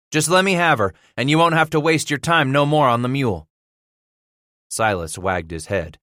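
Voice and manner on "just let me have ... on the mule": lightly voiced pleading frontiersman, raised pitch; earnest Titus. A narrator's reading. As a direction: press the bargain urgently. Titus bargains for the mule.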